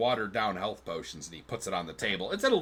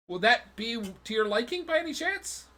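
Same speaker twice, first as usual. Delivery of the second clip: polite tanner; 10 semitones higher than usual. Acted. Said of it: "and he goes".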